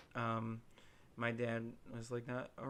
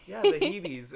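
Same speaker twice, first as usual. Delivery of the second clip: put-on louder, higher, insisting in grandma's Puerto Rican accent; insistent accented Hebes; 8 semitones higher, 14 dB louder, slower than usual. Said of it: "the thickest Puerto Rican accent".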